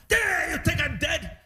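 slow, high, offended complaint; a hint of a huffy old father; imitating dad sulking over the wheelchair; mad. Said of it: "He was so mad".

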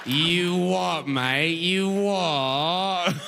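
hammed-up, drawn-out British slang; cartoonish British lad accent; mock British. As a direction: parrot a British accent for fun. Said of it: "when you meet somebody British".

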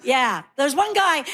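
ordinary talk

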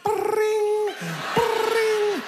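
high rolled "brring"; imitated phone ring. Comedian mimics a phone ringing mid-show, high trilled ring.

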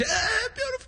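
high-pitched comic wail; mock crying. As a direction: imitate a father blubbering, moved to tears. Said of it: "everything made him cry".

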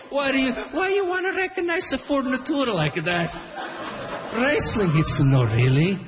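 a mocking Italian-accent tirade at the kids; Italian father. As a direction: rant like an excitable Italian dad. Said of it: "if I would have said it like my Italian father".

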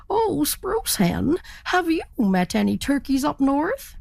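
a lightly voiced earnest turkey; eager turkey. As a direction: ask with wide-eyed hope. Turkey asks the hen.

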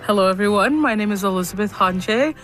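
ordinary talk, nothing marked

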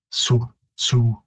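"Sioux" as a slow, isolated "soo"; demonstrated sound. Shown as a demonstration. Linguist showing how the final I W merges, sounded out. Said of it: "merging to more of a Sioux".

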